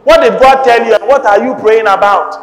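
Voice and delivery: forceful preaching. Real, not acted. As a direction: press the congregation with driving questions. Exhorts congregation to pray.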